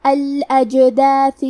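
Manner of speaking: الاجداث slowly, the silent جیم given a small bounce; qalqala on jeem. Shown as a demonstration. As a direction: let the جیم in الاجداث echo. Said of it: "ہم حرف الجیم کو ہلاتے ہوئے یہ کلمہ کو پڑھیں گے". (جیم پر قلقلہ)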